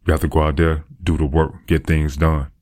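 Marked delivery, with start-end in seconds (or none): none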